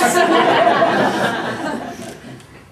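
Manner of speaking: loud laugh, high then falling. Real, not acted. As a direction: chuckle at the memory. Laughing at the hundred strokes.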